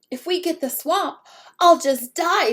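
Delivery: high, loud dramatic wail; a kid's overblown despair, pitched high. Acted. Nervous kid despairing.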